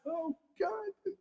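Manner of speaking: very high, wailing mock sob; hammed-up tearful plea; mock crying lament. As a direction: whine to Krishna in mock despair.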